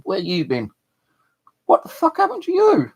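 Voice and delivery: mum's voice shooting up high and loud with alarm; a frantic mother yelling at her son coming home hurt; rising from a high worried call to a loud shriek; shocked mum. Retold or imitated. Mum voicing alarm in story.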